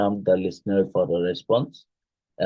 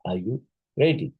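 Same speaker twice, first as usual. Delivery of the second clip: "Are" low, climbing to a higher "ready"; low start, rising end; 4 semitones higher than usual. Shown as a demonstration. Teacher voices the example sentence, rising from "Are" to "ready". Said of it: "and rise at the end".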